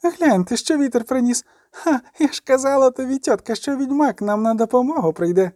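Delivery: high, cheeky voice calling out; impudent, high-pitched crowing; brash girl. A narrator's reading. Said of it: "крикнув раптом знайомий нахабний голос".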